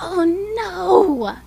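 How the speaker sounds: loud, high horrified oh no; dismayed cry. Acted. Boy realizing he caused it all, dismayed cry. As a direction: cry out as the truth lands.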